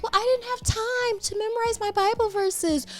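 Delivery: put-on high, whiny little-kid excuse. A child excusing herself to the Sunday school teacher, high and whiny. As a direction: pitch it up like a kid dodging blame.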